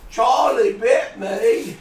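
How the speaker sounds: high, slow mock British child; Charlie bit me boy. Host quoting the viral video, mock British child. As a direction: imitate the little boy from the video. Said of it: "the little boy with the accent says".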